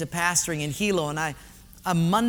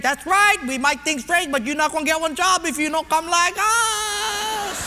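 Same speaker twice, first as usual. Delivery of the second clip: high-pitched pidgin retort; personnel guy, defiant; 8 semitones higher, 3 dB louder than usual. Acted. Personnel guy tells off the haole, high and defiant. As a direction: raise it into a stretched final taunt.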